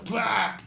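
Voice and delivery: high comic death squawk; goblin's silly last gasp; mock death cry. Goblin dying, high comic death cry.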